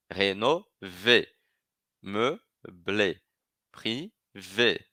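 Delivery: rénover, meubler, privé said slowly, each final é sounded clearly; slow model pronunciation. Shown as a demonstration. Teacher slowing down to show students the final é. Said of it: "eu vou pronunciar".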